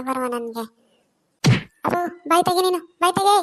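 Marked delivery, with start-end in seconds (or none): 1.8-2.8 loud, higher angry command; angry order. Acted. Wife orders drunk husband to shut up, loud and angry. 3.0-3.4 loud, high furious yell; furious shout. Acted. Wife screams at drunk husband in fury. Wife's quarrel peaks.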